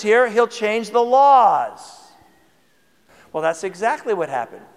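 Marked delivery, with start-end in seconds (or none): none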